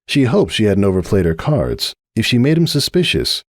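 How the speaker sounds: usual speaking voice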